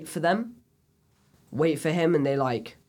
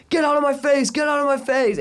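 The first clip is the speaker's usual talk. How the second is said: high, raised-voice mock shout; retold shout; 10 semitones higher, 5 dB louder than usual. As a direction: imitate an angry outburst at mum. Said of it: "He just, like, shouts".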